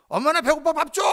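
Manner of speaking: put-on child calling mom. A child asking mom for dinner, loud childish call. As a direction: shout like a kid home from school. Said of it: "그 얘기를 왜 못하냐".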